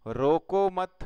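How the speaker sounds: "रोको मत" loud and high as one unit; stress on रोको मत. Shown as a demonstration. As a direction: punch "रोको मत" together, then break. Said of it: "के बाद में अल्प विराम लगाने".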